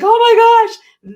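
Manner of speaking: high, loud, drawn-out exclamation; excited realization. Retold or imitated. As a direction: act out an eye-opening discovery, loudly. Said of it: "that I was like".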